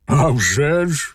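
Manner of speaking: slow, put-on gruff voice; rough-voiced ogre. A narrator's reading. Ogre confirms his power to the cat, slow and gruff. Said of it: "відповів людожер грубим голосом".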